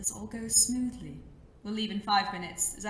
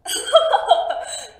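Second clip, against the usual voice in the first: loud high evil laugh; villain laugh; 14 semitones higher, 15 dB louder than usual. Acted. Villain laughs loudly on seeing his rival arrive. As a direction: cackle with glee at the hero.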